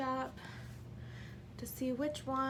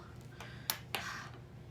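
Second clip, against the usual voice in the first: long breathy sigh out; whispered heavy sigh; 10 dB quieter than usual. Real, not acted. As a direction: let out a tired sigh.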